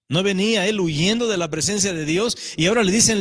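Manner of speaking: usual speaking voice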